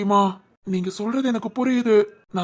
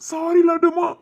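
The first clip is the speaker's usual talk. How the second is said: anguished apology; 5 semitones higher, 5 dB louder than usual. Acted. Father apologises to crying daughter.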